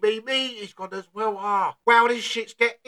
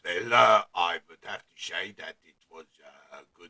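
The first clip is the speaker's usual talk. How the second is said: deep Arnie voice; 14 semitones lower, 3 dB quieter than usual. Acted. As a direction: drop into a deep sluggish Arnie growl. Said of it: "that voice has to go".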